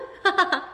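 bright laugh at the trick; amused laugh. Acted. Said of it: "I can't help laughing".

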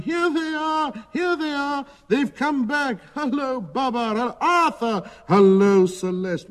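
high-pitched, joyful cries of welcome; very high, delighted hailing shouts; excited shouting. A narrator's reading. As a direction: call out in high excitement, like a cheering crowd. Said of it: "running and shouting".

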